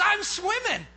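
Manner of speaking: high, loud declaration; insistent shout. Retold or imitated. Partner set on crossing the river, retold insistent shout. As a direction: shout it with stubborn resolve.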